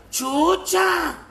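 a priest barking to stop her; a rebuke yelled across the patio; sharp shout. Acted. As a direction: shout her name high and loud, cutting her off.

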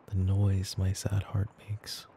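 soft whisper about heartache; mock-sad whisper. Girlfriend pretending heartache to her partner, whispering. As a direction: sigh it out as a playful lament.

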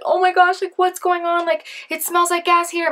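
put-on high-pitched alarmed teen on the phone; mock freak-out to mom; mock panicked call. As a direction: plead up high, acting out a freaked-out call home. Frightened call to mom, retold.

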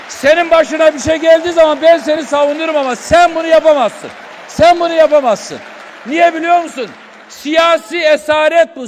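loud furious rant at a rival; heated shouting in a council row; real angry shouting. Real, not acted. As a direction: shout him down in anger.

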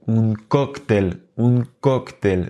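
"cóctel" stressed on the first syllable, COC-tel; accent sur le O. Shown as a demonstration. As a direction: punch the O in "cóctel". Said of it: "on accentue le O". (« cóctel » accentué sur la première syllabe, COC-tel)

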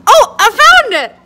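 high, loud, happy find; excited. Real, not acted. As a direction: burst out with delight. Finally finds the lighter.

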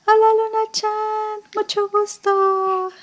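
put-on high, slow, drawn-out baby talk; sing-song baby talk. Mom greeting baby daughter Luna, high slow sing-song. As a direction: coo a hello to the baby, stretching every word.

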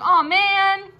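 a let-down groan; disappointed. Pretend. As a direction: letdown intruder saying aw man. Disappointed person voiced.

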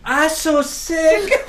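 slow, high, hammed-up Russian accent; drawn-out mock Russian accent. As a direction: play a sick man with a comic Russian accent. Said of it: "That was my Russian accent".

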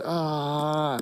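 Jesus grieving as the rich ruler leaves; Jesus lamenting. Retold or imitated. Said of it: "He goes".

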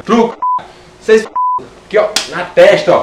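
loud high card-game shout. Acted. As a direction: slam the call out loud.

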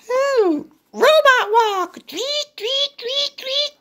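high little pig voice; Piggy calling the robot walk to Gerald, high voice. Acted. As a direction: pitch way up, playful little pig. Piggy calls the move.

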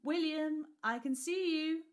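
high, loud call across a lawn. A narrator's reading. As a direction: call out playfully from afar. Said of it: "a voice called out to me".

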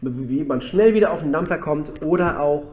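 ordinary talk

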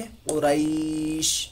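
क़ुरैश drawn out slowly, ending on a vowelless sh; stopped, stretched ending. Shown as a demonstration. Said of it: "यहाँ पर जज़म देंगे". (क़ुरैश खींचकर, शीन पर जज़म)

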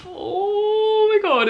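long, high, drawn-out awe; overwhelmed, near tears. Real, not acted. Vlogger first seeing the giant spell book, overwhelmed. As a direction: stretch it out high as if about to cry. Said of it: "I'm gonna cry".

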